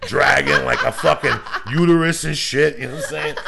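loud giggling riff; laughing rant. Real, not acted. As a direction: rant while cracking up. Laughing rant about apocalypse.

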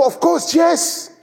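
high emphatic teacher's answer; emphatic reply. Retold or imitated. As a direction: answer with lofty, slow certainty. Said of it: "I go".

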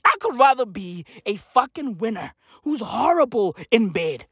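ordinary talk

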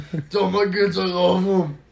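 normal talking voice

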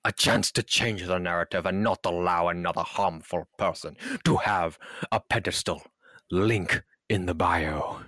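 pompous official announcement, hammed-up; mock professional statement. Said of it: "Yes, professional".

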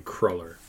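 Cruller with short vowel, "CROLL-er"; low, quiet Cruller without the "oo"; arguing pronunciation. Shown as a demonstration. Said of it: "But there's two L's".

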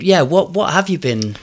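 normal talking voice

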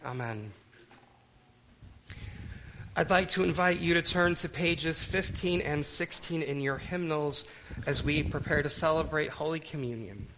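ordinary delivery

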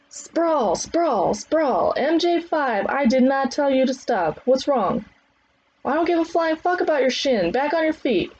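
high, projected drill orders; trainer's scolding calls. Acted. Trainer scolding MJ Five for stopping, high commanding calls. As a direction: order them back up, no sympathy.

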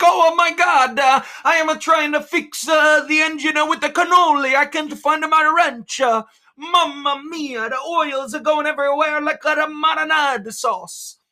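very high, squeaky hammed-up Italian accent with mamma mia; mock Italian accent. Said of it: "the Italians are running around like".

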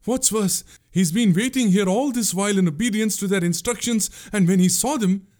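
high, urgent woman's voice; gasping confession. A narrator's reading. Pungurali confessing her brother's guilt to the prince, gasping. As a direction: pour out the shameful news, pitched high. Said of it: "she gasped".

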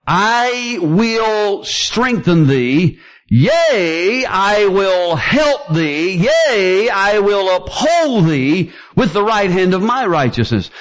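slow, rising preaching of the promise; fervent pulpit quote; building preaching. Acted. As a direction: lift it toward a climax.

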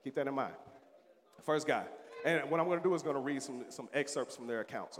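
ordinary talk, nothing marked

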